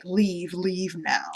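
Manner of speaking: lightly voiced vampire menace; scary vampire voice. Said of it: "in her scary vampire voice is like".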